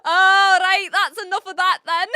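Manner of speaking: high, loud mock Scottish; loud Scottish accent before cracking up. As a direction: wrap it up in hammed-up Scots. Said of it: "my best attempt at a Scottish accent".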